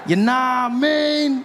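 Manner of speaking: "You nah mean?" slowed and slurred into one word; slang slurred. Shown as a demonstration. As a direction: stretch "nah mean" slowly. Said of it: "neither question nor statement".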